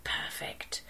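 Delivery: breathy whisper. A narrator's reading. Fisherman whispering as the birds hang above, hushed. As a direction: barely voice it. Hunter whispers as flock breaks.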